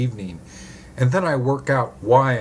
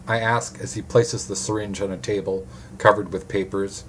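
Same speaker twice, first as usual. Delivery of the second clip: usual speaking voice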